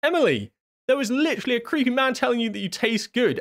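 high, incredulous protest; can't believe what she just heard; high-pitched disbelief at the poltergeist; shocked disbelief. Real, not acted. Shocked at the creepy voice.

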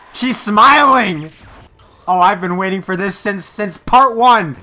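thrilled shout. Real, not acted. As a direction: cry it out in pure delight. Thrilled at the show.

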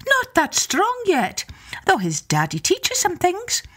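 high, lilting Irish wife; Una's voice. Acted. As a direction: sweetly put off the visitor in a high voice. Una voiced in story.

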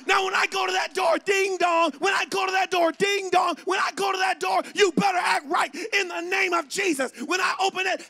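loud, high, hammered warning; mom threatening. Retold or imitated. Mom warns lined-up kids before guests arrive, loud threatening shout. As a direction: lay down the law at full volume. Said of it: "She'd put us in a line and go".

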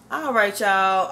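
ordinary talk

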